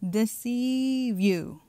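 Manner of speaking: "Deceive" stretched very slowly; drawn-out emphasis. Real, not acted. Preacher answers own question about demons, "Deceive" long and slow. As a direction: draw out "Deceive" as the big reveal.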